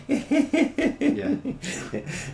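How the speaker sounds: big high burst of laughter; hearty laugh. Real, not acted. Laughs at the joke.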